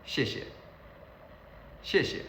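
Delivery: 谢谢 short and run together, second syllable light; everyday pronunciation. Shown as a demonstration. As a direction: say 谢谢 as one quick natural word. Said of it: "in our daily life we say it is".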